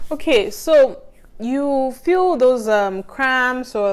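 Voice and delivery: slow, recovering from breathlessness; slow, still catching her breath. Real, not acted. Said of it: "I need to catch my breath".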